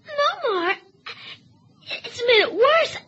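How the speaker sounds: loud, tearful panic; panicky crying. Acted. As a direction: cry out in fear, speeding up. Boy choking on fish bone.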